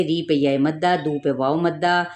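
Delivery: ordinary delivery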